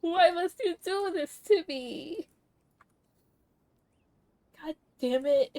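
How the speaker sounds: a real, pained cry of protest; upset at the game. Real, not acted. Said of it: "I'm crying again".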